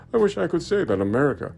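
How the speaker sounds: normal talking voice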